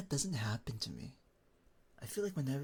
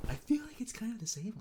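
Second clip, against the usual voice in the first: voice slightly higher; 11 semitones higher, 3 dB louder than usual. Real, not acted. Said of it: "your voice got slightly higher in English just now".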